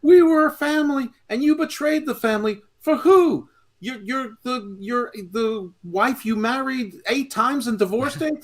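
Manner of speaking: loud, very high hammed-up accusation; Jay. Fan playing betrayed wrestler blaming his brother, hammed-up outrage. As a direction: wail it like an outraged wrestler's promo. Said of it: "And, and Jay's like".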